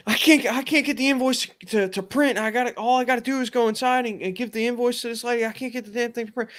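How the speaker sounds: high, stammering, rushed imitation; frantic friend tripping over his words; retold panic. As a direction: reenact a coworker spiraling over a small problem. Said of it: "I can hear the panic in his voice".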